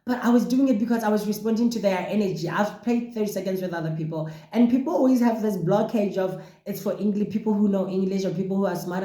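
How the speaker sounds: ordinary talk, nothing marked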